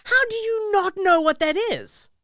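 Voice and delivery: high, loud disbelief, slowing down; can't believe you don't know this; incredulous. Real, not acted. A friend stunned that the host doesn't know the quote, high and loud disbelief.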